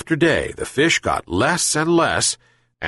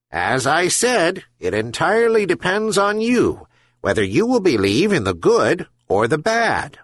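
patient herring gull; 6 semitones higher than usual. A narrator's reading. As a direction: draw it out like a patient old seabird. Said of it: "but the gull continued".

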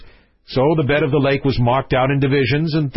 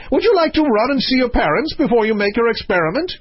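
high, quick royal offer; excitable king; 10 semitones higher, faster than usual. Acted. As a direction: rattle it off as the high-voiced king.

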